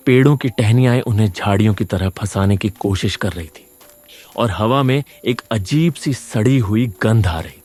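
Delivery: usual speaking voice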